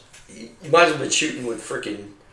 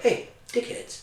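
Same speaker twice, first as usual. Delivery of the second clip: slow, high and soft mock call-out; retold crewmate's call; 12 semitones higher, 8 dB quieter, slower than usual. Crewmate popping down to the two gunners, slow mock call-out. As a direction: draw it out, softly pitched up. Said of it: "Wagner pops down, and he's like".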